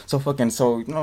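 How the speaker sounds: normal talking voice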